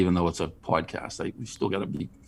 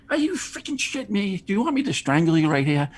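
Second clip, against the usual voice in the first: higher, exasperated mock rant; mock outrage; 12 semitones higher than usual. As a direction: play up the disbelief at the dumb question. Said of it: "I'm like".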